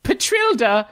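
high-voiced, showy dwarven vendor; eager high-pitched stallholder patter; dwarven fried-food conjurer. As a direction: pitch your magical fry booth in a high voice.

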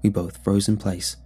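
plain reading voice

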